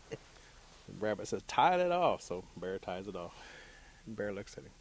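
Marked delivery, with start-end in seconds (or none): 1.5-2.2 loud, higher command; Rabbit's order. A narrator's reading. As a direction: call out an instruction to your helper. Hanging Rabbit orders Bear.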